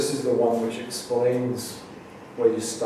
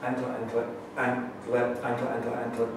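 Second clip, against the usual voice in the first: soft, rhythmic word repetition; poem recital; 5 dB quieter than usual. Acted. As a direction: repeat in a quiet, even patter, then land the last word. Reads own poem aloud.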